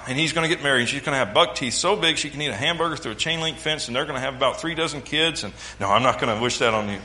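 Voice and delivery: ordinary talk, nothing marked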